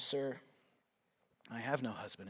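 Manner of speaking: ashamed admission. Acted. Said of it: "puts her head down".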